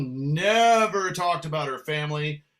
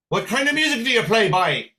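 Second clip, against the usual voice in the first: loud, higher Irish pub challenge; gruff-challenging regular; 6 semitones higher, 5 dB louder than usual. Retold or imitated. Pub regular challenging newly arrived musician, loud demand. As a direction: demand it loudly, like a confronting regular. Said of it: "one of the regulars walks right up".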